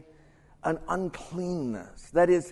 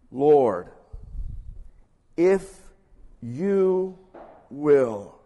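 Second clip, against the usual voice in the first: kneeling leper's plea; 4 semitones lower, 6 dB louder, much slower than usual. Acted. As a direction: plead haltingly with long pauses, dropping low at the end. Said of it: "he states his perception".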